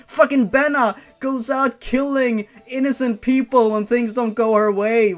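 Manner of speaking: loud, high exasperated rant; loud outraged complaint. Real, not acted. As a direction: vent at these awful characters. Ranting about the characters.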